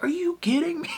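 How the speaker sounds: squeaky high are you kidding me; incredulous. Retold or imitated. Viewer reacting to the host, shrill high disbelief. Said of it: "and I'm like".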